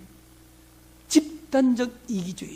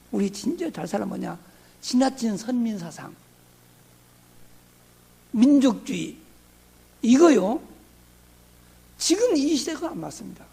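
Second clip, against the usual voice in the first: ordinary talk, nothing marked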